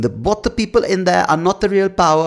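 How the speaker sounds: high-pitched mock German accent. Podcaster mocking a young activist's summit speech, high mock German accent. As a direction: imitate her in a high, put-on German accent. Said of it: "I don't know why she's German".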